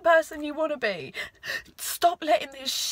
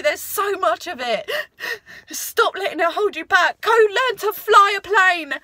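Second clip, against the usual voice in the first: louder, urgent, voice cracking with tears; tearful encouragement; 4 semitones higher, 6 dB louder than usual. Real, not acted. [name] pleading with herself to enjoy life, tearful and loud. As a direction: urge yourself on through crying. Tearful self pep talk continues.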